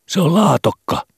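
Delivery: put-on very high, loud boy's voice; squeaky, over-eager pupil; schoolboy blurting out. A narrator's reading. Frightened boy blurts out an answer.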